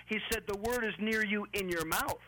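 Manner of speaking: normal talking voice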